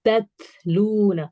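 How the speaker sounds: slow تتلون with breath after the first closed T; drawn-out "tat-loona" with flowing T; hams. Shown as a demonstration. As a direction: recite تتلون slowly, airy T, long "loo". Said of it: "اس میں ہمس کی sound آئے گی".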